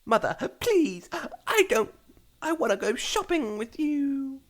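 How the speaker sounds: high pleading little filly; little girl voice. Acted. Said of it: "A little filly Rarity pleaded".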